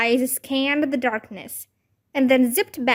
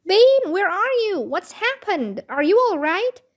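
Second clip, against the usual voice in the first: high-pitched, urgent calling out; alarmed yelling; 7 semitones higher, 3 dB louder than usual. A narrator's reading. Babysitter hunting for the screaming girls, alarmed yelling. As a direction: yell for a missing child in alarm. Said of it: "Nancy was yelling too".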